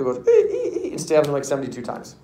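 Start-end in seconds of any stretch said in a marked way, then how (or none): 0.3-0.9 high stabbing horror-film shrieks; psycho screech. Retold or imitated. Teacher imitating a bathtub stabbing, psycho screech. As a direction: mimic piercing slasher-movie screeches. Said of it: "like, all psycho".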